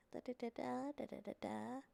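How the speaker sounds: ordinary delivery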